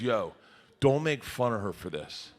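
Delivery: a hint of a low serious wife; lightly voiced wife, scolding gently; wife's voice. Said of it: "She goes".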